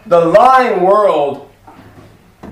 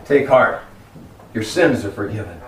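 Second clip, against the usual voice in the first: Jesus speaking; 9 semitones lower, 5 dB quieter than usual. Acted. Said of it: "and he said".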